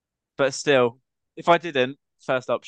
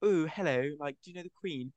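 imitating a Queen's English accent, softly, starting higher; 3 semitones higher, 8 dB quieter than usual. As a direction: put on the English accent tourists expect. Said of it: "how they probably expected everyone to have".

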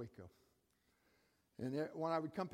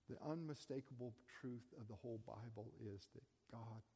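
soft closing appeal; 2 semitones lower, 6 dB quieter than usual. Acted. Preacher closing gently to the congregation, soft and tender. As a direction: drop to almost a hush and let it land.

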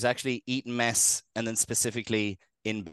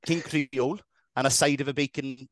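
higher-pitched mock Welsh; amateur Welsh impression, pitched up; Welsh accent; 4 semitones higher than usual. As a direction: do a put-on Valleys accent. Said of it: "don't speak in Welsh".

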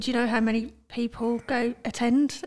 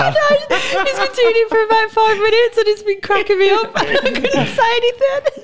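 laughing; 14 semitones higher, 4 dB louder than usual. Real, not acted. Dog drinks guest's water.

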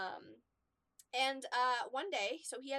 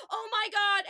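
alarmed exclamation; 10 semitones higher, 11 dB louder, slower than usual. Retold or imitated. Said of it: "we just hear him go".

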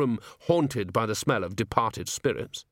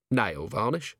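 puzzled echo question; slower than usual. Acted. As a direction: repeat it as a baffled question. Vimes echoes it, puzzled.

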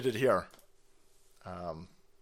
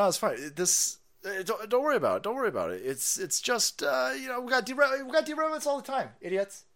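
dismissive official voice, rising higher; mocking officials; 6 semitones higher than usual. Mocking officials brushing off the residents, rising higher. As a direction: brush it all off like a smug spokesman. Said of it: "they keep telling everybody".

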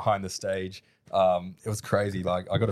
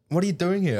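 higher, surprised call of recognition; a friend spotting him unexpectedly, pitched up; surprised greeting; 10 semitones higher than usual. Retold or imitated. Retelling a friend's surprised greeting at the festival, higher and bright. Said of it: "she was like".

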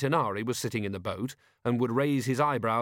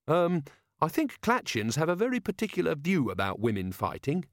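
hesitant, polite, higher-voiced subordinate; tentative Carrot; 6 semitones higher than usual. Carrot cautioning about women fighting, tentative and polite. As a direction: raise the objection carefully.

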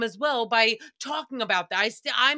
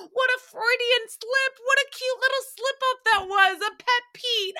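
very high, loud teasing voice; teasing squeal; 13 semitones higher, 4 dB louder than usual. Real, not acted. Laughing at her own slip of the tongue, very high.

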